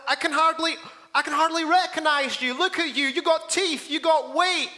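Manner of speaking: high-pitched, excited retold amazement; amazed greeting. Old acquaintance greets the recovered cancer patient, amazed. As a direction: act out happily astonished recognition.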